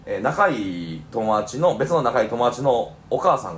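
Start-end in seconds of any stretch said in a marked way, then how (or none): none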